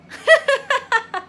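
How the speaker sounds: high, loud burst of giggling; amused laugh. Acted. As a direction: laugh out loud at a brother's boredom. Laughs about brother being bored. (malakas na hagikgik)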